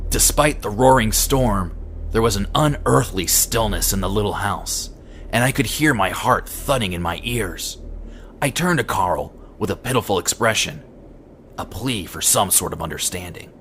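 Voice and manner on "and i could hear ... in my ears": usual speaking voice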